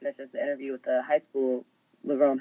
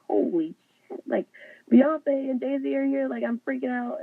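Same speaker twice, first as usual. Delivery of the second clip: freaking out; 10 semitones higher than usual. Retold or imitated. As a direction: squeal it out like you can't believe who's in the room. Texting friends about [name].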